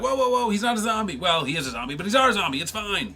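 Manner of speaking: high-pitched comic damage control; frantic reassurance. Acted. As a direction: pitch up, hurrying to settle a panic. Creeper calming panicked kids.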